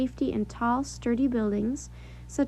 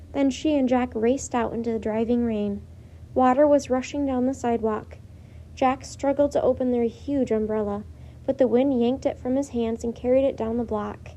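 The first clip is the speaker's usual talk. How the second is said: ordinary delivery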